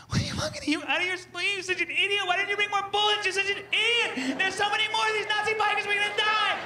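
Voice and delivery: high, loud panicky shrieking; panicked high-pitched girls; mock panicked girls. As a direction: shriek at him like a panicked girlfriend. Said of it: "the two girls are like".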